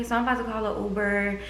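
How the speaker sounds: usual speaking voice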